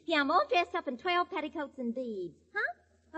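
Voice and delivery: normal talking voice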